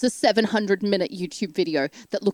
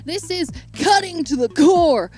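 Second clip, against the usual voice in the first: mock hurt fans; 7 semitones higher than usual. Mocking offended fans.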